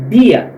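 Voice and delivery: dia with a hard dental d, not dji; tongue-tip d. Shown as a demonstration. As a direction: keep dia's d on the tongue tip. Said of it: "dia na ponta da língua". (dia com D na ponta da língua)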